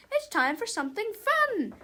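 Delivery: high-pitched teacher voice; teacher announcement. A narrator's reading. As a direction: announce a treat to the class. Acted teacher addressing her class.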